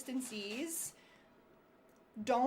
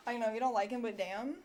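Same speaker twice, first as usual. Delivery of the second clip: usual speaking voice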